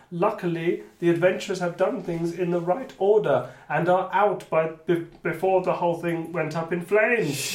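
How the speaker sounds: animated storytelling, loud opening; game master narration. Acted. Game master telling players of their fiery escape, animated narration. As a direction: launch loudly into the outcome, then keep it lively. Reads the outcome.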